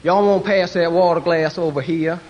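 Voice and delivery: mild Southern Texas twang; Texas accent. Retold or imitated. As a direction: imitate a Texas waitress, lightly. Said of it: "love the Texas accents".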